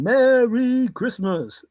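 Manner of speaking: slow drawn-out Merry Christmas; mock Father Christmas; Santa voice. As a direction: greet like a jolly Santa.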